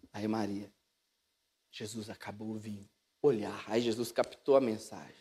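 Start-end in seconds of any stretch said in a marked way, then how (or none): 1.7-2.9 low, very soft, pointed reminder; recado baixinho. Acted. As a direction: murmur it meaningfully to your son. Maria insists with a loaded look. (falando baixo, com intenção)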